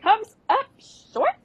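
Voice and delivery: high, slow indignant repeat; outraged disbelief. Retold or imitated. Miranda reading the bad review, high slow outraged echo. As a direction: repeat it in shock. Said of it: "And she goes".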